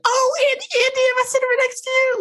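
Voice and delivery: very high, fast, breathless squeal of delight; squealing, over-the-top thrilled impression; excited Sheree impression. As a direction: gush like a giddy fan who got the best seat. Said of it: "she's like".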